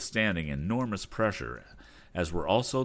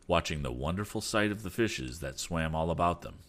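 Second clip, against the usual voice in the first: ordinary delivery, nothing marked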